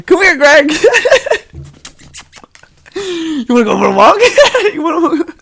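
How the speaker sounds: high, loud laughing coo to a dog; mock dog-owner baby talk. Imagined owner inviting dog Greg for a walk, high laughing coo.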